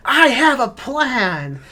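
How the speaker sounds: high, loud drawn-out yell; Pat Healy yelling. Retold or imitated. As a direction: yell it out, stretching every word. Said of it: "Pat Healy yells".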